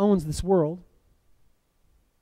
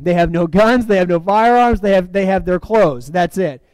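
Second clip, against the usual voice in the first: ordinary delivery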